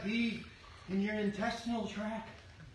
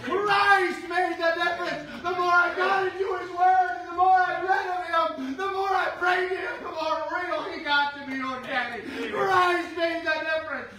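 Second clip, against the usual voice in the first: son's joyful testimony; 6 semitones higher, 7 dB louder than usual. Retold or imitated. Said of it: "He said".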